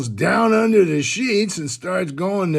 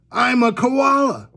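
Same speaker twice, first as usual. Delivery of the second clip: higher-pitched, emphatic retort; mock indignant declaration; 6 semitones higher than usual. Koala refuses to pay the woman, emphatic mock indignation. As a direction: state it as if obvious, play-acting.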